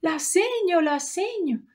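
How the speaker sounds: put-on high tearful child's voice; crying child. Daughter telling her mother who hit her, high and tearful. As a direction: whimper it through tears like a small girl. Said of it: "de repente empieza a llorar".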